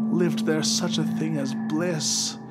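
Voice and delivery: ordinary delivery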